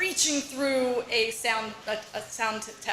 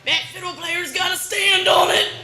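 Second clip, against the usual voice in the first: put-on higher, louder old-man grumble; crotchety uncle; 7 semitones higher, 5 dB louder than usual. Uncle grousing about the fiddle player, raised and loud. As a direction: grumble like a cranky old picker.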